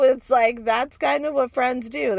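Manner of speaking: ordinary talk, nothing marked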